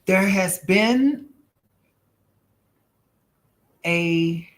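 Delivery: slow, drawn-out medium voice; psychic reading. Acted. Giving a viewer a spirit reading: slow and drawn out. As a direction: let each word come as if received.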